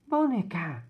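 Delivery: put-on loud high child's cry of a name; little brother calling to his babysitter. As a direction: yell for her attention like a small boy.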